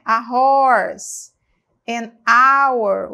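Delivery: loud model contrast; contrast h sounds. Shown as a demonstration. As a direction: contrast the h in "A horse," and the silent h in "an hour.". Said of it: "o som de A".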